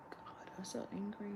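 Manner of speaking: hushed, voiceless aside; barely audible venting; whispered exasperation. Real, not acted. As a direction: whisper it under your breath. Aside while reliving her anger.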